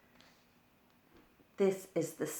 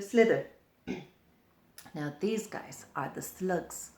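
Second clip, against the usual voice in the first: ordinary delivery, nothing marked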